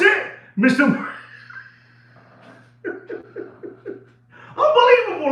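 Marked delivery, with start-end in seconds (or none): 2.8-3.9 short breathy laugh; laughing under the breath. Real, not acted. Laughs at his own story. 4.6-5.3 high laughing exclamation; real laugh on the word. Real, not acted. Laughing right after a laugh.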